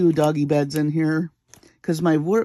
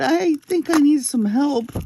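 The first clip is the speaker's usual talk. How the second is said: sheepish call for help; 10 semitones higher than usual. Retold or imitated. Asking mother for help from floor.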